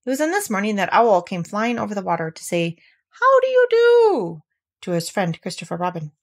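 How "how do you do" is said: high, slow hooting owl voice; Owl's hooting greeting. A narrator's reading. Owl greeting his friend across the water, high and slow. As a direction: stretch it out like a lofty owl.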